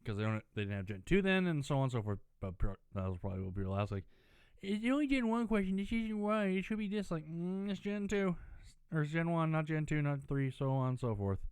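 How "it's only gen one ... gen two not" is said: high mocking voice of a pedantic fan; mocking nitpicker. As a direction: lift it up and mock the know-it-all. Mocking fussy quiz-answer complainers.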